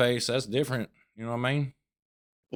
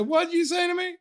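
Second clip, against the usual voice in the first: very high, outraged disbelief; indignant high squeal. Pretend. Storyteller answering the woman in a high outraged squeal. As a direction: shriek it like you can't believe her. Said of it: "I said".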